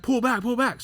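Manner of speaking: high-pitched mock cockpit shout; urgent command. Instructor to trainee near the landing strip, urgent. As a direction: imitate an instructor urgently ordering.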